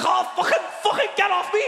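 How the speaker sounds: high, loud mock shriek; wife's shriek. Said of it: "She's like".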